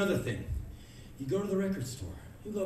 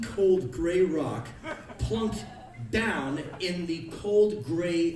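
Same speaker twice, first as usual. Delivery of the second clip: slow disgust; much slower than usual. Acted. Slow spiteful emphasis.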